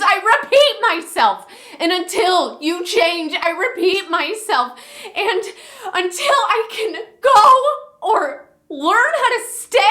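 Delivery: high-pitched, anguished outburst; desperate monologue. Acted. Woman carrying too much for so many people, desperate. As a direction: plead at breaking point, voice far above normal. Performs a monologue at breaking point.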